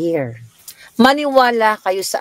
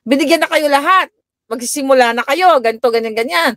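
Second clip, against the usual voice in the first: mock scolding; 7 semitones higher than usual. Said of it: "Sabi ko nang ganon".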